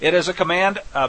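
ordinary talk

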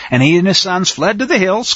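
ordinary delivery

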